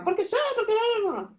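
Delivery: protesta airada, atropellada y muy aguda; enojo imitado. Acted. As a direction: imitar un berrinche con voz aguda. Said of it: "Si ustedes se enojan". (quejarse enojado, en tono muy alto)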